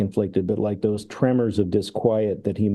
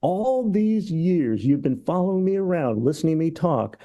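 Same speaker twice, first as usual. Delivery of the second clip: mock outrage, pitched much higher; indignant tirade; 7 semitones higher than usual. Socrates turns on his followers over the hemlock, indignant tirade. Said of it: "he goes into this tirade".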